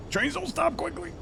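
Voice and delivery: very high, squeaky comic voice. Acted. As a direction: squeak it out in a cartoon voice. Same line repeated, squeaky voice.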